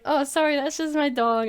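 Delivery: high, loud cheerful sorry; bright apology. Retold or imitated.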